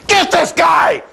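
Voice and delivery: high, loud mock scream down the phone; imitating a scream. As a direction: yell it like an excited colleague. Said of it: "he screams as I answer".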